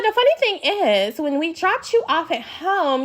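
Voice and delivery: high teasing voice. Retold or imitated. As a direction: drag out the punchline. Quoting Mia again.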